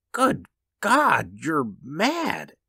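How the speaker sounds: high, horrified exclamation; aghast. A narrator's reading. Husband horrified by his wife's plan, aghast. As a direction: cry out in shock.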